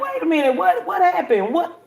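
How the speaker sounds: high, alarmed wait what; retold alarmed confusion. Confused when ordered out.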